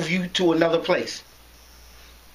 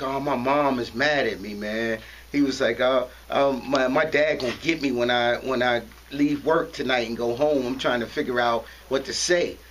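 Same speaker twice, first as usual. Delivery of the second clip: put-on mumbling worried teen; 9 semitones lower than usual. Scared teenager tells his big-brother figure, low worried mumble. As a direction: mumble it like a scared kid caught skipping.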